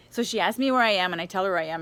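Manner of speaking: ordinary talk, nothing marked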